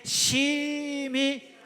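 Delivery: 심히 held long, high and loud; stress on 심히. Shown as a demonstration. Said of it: "좀 힘있게 부딪혀서 심히".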